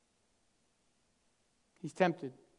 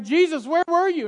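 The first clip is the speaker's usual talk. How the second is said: frantic parents; 10 semitones higher, 9 dB louder than usual. Pretend. Parents frantically calling Jesus.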